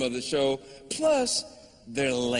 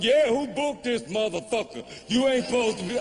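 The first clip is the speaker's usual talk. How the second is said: acted higher-pitched heckle; heckling dude; 7 semitones higher than usual. Man in audience objects to the booking, mock heckle. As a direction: play an indignant man complaining from the crowd.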